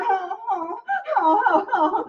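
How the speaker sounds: very high, soft whimpering coo; a little baby-like whine. Acted.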